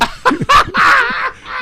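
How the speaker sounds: high-pitched burst of laughter. Real, not acted. Cracks up at the surname.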